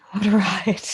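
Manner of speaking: breathy whispered "What a ride"; whispered aside. Real, not acted. Whispered repeat.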